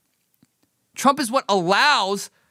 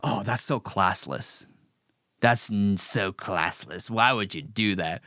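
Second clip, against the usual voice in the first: lower, softer, slow mocking tut-tut; mock prissy disapproval; 10 semitones lower, 6 dB quieter than usual. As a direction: drawl out the scolding like a stuffy critic. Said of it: "Even conservatives saying".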